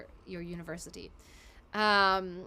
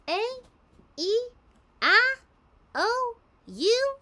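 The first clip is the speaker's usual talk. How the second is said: vowels in an exaggerated swung country accent, rising high; Applin country vowels; 13 semitones higher, 10 dB louder, slower than usual. Shown as a demonstration. Voice actor demoing her Applin accent for fans. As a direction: sing the vowels out like a silly country filly. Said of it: "Here's the vowel".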